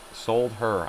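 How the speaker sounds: solder said as two words, Sold her, falling at the end; sold her pronunciation. Shown as a demonstration. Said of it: "you have to say "sold her".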